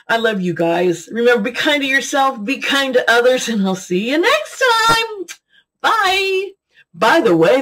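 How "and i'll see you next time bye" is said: lilting high sign-off, pitched way up; sing-song goodbye. Real, not acted. Signing off to her viewers in a high sing-song. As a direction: float the goodbye up high and bright.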